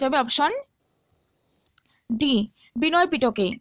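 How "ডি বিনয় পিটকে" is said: ordinary delivery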